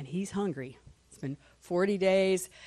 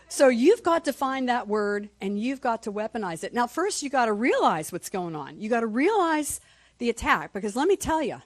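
ordinary talk, nothing marked

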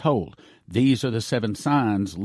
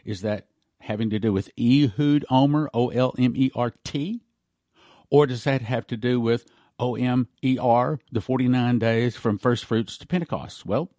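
normal talking voice

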